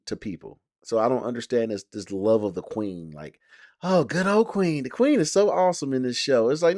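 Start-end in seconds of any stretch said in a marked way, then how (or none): 3.8-6.5 lightly raised, mock-adoring voice; sarcastic gush. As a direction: mimic fans gushing over the queen, with a sneer. Mocking fans who love the queen.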